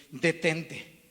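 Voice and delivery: a loud, firm stop; firm command. Retold or imitated. Stopping the woman's kiss with a loud firm command. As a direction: halt her with one forceful word. Pastor stops woman's advance.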